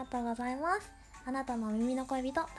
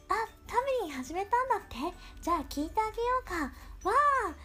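excited; 8 semitones higher than usual. Retold or imitated. Voicing listeners' eager reaction.

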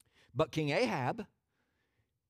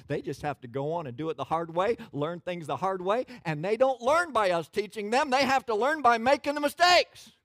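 exasperated preacher building to a loud, high peak; preaching, building; 7 semitones higher, 7 dB louder than usual. Real, not acted. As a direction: climb in pitch and volume with each phrase. Preaching builds to full force.

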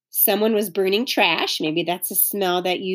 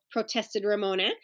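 usual speaking voice

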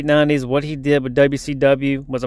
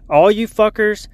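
raised, heated jab at the listeners; aggressive; 6 semitones higher, 3 dB louder than usual. Real, not acted. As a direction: get aggressive, pitch jumping up. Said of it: "I'm getting aggressive".

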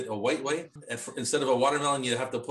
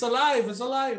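high, excited proclamation; mock monster-movie cry; 11 semitones higher, 5 dB louder than usual. Riffing on the film with co-hosts, mock monster-movie cry.

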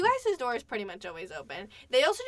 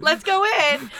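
higher, louder "let's go in"; eager go-ahead; 7 semitones higher, 9 dB louder than usual. Retold or imitated. Friend deciding to enter her room, loud and eager. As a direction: egg them on, loud. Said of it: "'cause you were like".

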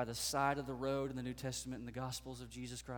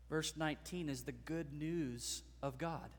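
normal talking voice

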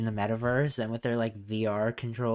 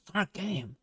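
higher-pitched mimicked protest; mock insistence; 6 semitones higher than usual. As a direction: imitate someone defensively insisting. Mimicking defensive metaverse boss.

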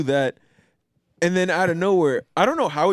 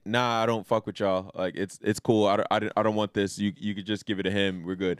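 low dismissive voice; 9 semitones lower, 6 dB quieter than usual. Retold or imitated. Bo-Katan voiced, turning down redemption.

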